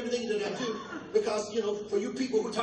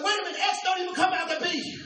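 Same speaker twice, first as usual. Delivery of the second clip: mock confused outcry; 7 semitones higher than usual. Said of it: "I'm like".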